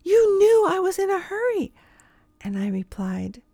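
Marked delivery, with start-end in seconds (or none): 0.0-1.7 much higher, mocking imitation of her; impatient lady. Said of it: "She cut me off".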